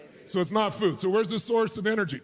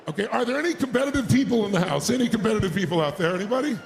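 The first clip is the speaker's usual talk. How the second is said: normal talking voice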